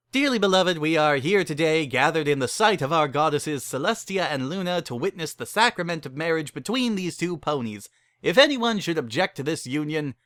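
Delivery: mock wedding officiant. Barry officiates the wedding for the couple, mock solemn. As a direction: intone it like a chapel preacher.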